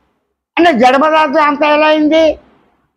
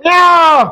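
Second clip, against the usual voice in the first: cat mimicry; 4 semitones higher, slower than usual. Shown as a demonstration. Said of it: "mimicry నేర్చుకుంటున్నాను".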